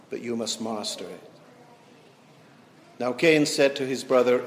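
measured, solemn Bible reading voice; preacher reading Genesis to the congregation, solemn and measured; scripture reading. Acted. Pastor reads Cain and Abel aloud, solemn.

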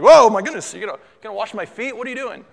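mock startled yelp, then quick high questioning; startled Peter. Peter as Jesus picks up his foot, mock astonished. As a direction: blurt it out in hammed-up astonishment. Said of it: "and Peter's like".

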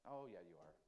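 soft, low, reassuring reply; God coaxing a reluctant believer, soft and low; tender God's voice. Acted. God answers in acted dialogue.

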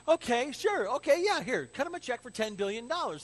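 high, loud, hammed-up okay; breezily agreeable king. King to man pitching for billions, breezily agreeable. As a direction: hand it over far too readily. Acts out king granting the loan.

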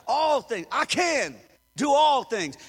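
loud, slow, fervent shout; shouted declaration. Acted. Preacher declaring scripture aloud, fervent shout. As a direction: belt it out like a battle cry.

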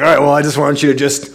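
put-on friendly Brooklyn-accented customer, up and quick; Phil's Brooklyn accent. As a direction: belt it out like a cheerful Brooklyn guy. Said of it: "come out with this great Brooklyn accent".

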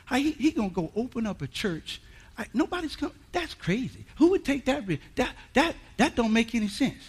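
quiet, hushed gossip voice; mocking gossipers. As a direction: mimic people whispering behind his back. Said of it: "Talking about".

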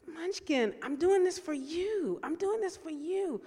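high pleading voice, well above her own; pleading with the cat. Retold or imitated. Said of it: "And I was like".

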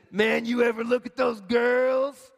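slow, lightly voiced creepy dude; hint of a leering man; slimy guy voice. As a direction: drawl it out like a sleazy guy. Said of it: "I don't know if he really talked like that".

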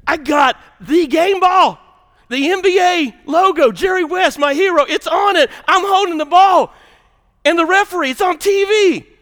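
loud, high, ecstatic; excited shout. Real, not acted. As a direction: shout out the big moment. Catching the game ball.

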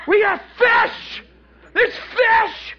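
high, loud whoop of discovery; astonished excitement. Acted. Peter finding the nets full, excited yelling. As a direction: yell it out, thrilled and amazed.

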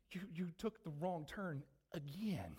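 soft, slow wife's whisper; gentle whisper. Retold or imitated. Wife corrects husband gently in his ear, soft slow murmur. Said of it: "she whispers real gently in my ear".

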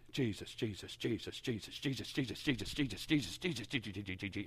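mock prayer, soft machine-gun stutter of "Jesus"; rapid-fire repetition. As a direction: rattle it off low, speeding up like gunfire. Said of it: "it sounds like an A K forty-seven".

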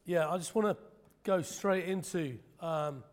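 normal talking voice

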